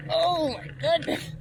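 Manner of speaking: real high, drawn-out cry of effort; straining with effort. Real, not acted. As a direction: groan it out high while climbing a steep hill. Straining up a steep hill.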